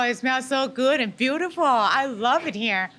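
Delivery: ordinary talk, nothing marked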